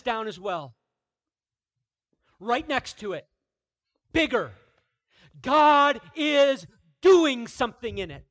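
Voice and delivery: loud, high, ringing pulpit voice; preaching at full force. Acted. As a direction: proclaim it to the whole church. Preacher hammering his point home.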